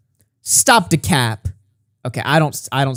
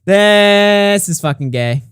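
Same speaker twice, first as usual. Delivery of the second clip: slow, drawn-out, louder and higher outcry; loud cringing exclaim; 6 semitones higher, 5 dB louder, much slower than usual. Real, not acted. Embarrassed by own old messages, a drawn-out loud outcry.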